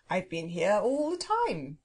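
slow, understated polite yawn of a voice; lightly voiced languid phoenix; unhurried, with a touch of drowsy politeness; politely yawning phoenix. A narrator's reading. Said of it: "yawning politely behind its claw".

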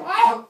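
sudden shrill falling scream; high loud yelp. Pretend. Baby in mouth.

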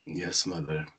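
a dark, loaded "Yes, Mother"; dark, cryptic. Acted. Constantine answers his mother after the slap, low, soft and dark. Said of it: "His voice turns dark, cryptic".

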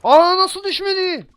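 high loud astonished cry; shocked he did not fall; surprised. Real, not acted. Surprised enemy still standing.

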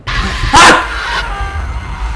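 sudden high yelp of fright; startled scream. Real, not acted. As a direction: scream at a jump scare. Startled by a jump scare.

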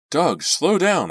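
high, loud, slow plea; frightened cry. Acted. Girl in back seat begging reckless driver, frightened loud cry. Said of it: "I cried".

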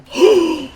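loud, high gasp; startled gasp. Real, not acted. Gamer suddenly seeing something in the game, startled gasp. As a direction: gasp sharply in sudden shock.

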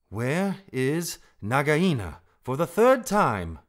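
insistent cross question; exasperated. A narrator's reading. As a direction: spell it out with lost patience. Rikki asks for third time.

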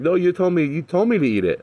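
mock the son's teary complaint; hammed-up whiny child voice; imitating crying son. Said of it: "He's like".

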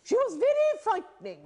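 high, imperious grande dame voice; a haughty old actress, held high; Fabia Drake impression. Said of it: "And she spoke like that".

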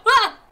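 high, loud yelp; startled scream. Real, not acted. Player seeing the monster move, screaming. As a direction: screams in fright. Creature suddenly moves.

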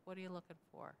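usual speaking voice

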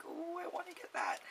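high, soft wanting ooh; covetous ooh. Retold or imitated. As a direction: sigh over something you crave. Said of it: "I'm like".

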